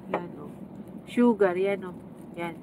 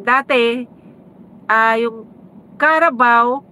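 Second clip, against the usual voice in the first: usual speaking voice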